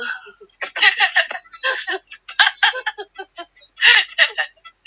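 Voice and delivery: high, loud bursts of eerie giggling; imitated high ghost laugh; ghostly giggle. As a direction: cackle like a ghost, high and sobbing. Imitates the ghost after naming it.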